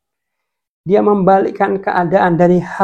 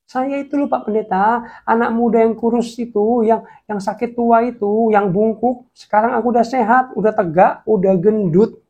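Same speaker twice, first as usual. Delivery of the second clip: put-on eager young man; 8 semitones higher than usual. Healed young man greets pastor.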